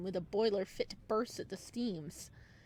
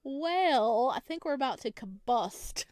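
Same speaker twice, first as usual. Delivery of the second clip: louder, giddy and flustered; 4 semitones higher, 7 dB louder than usual. Real, not acted. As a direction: blurt it out, overwhelmed. Reacting to romantic scene.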